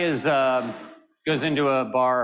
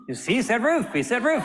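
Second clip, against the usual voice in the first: excited insisting; 11 semitones higher than usual. Retold or imitated.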